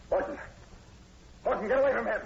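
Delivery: loud, high commanding yell; shouted warning. Acted. The sergeant to Horton over the professor, shouting a warning. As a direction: stop him with a shout.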